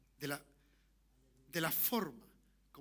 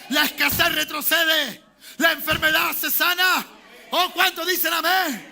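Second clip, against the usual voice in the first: full-force preaching; 7 semitones higher, 12 dB louder than usual. Real, not acted. Preacher promising the church God's remedy, full-force proclamation. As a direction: hurl each promise out to the congregation.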